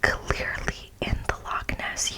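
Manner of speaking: usual speaking voice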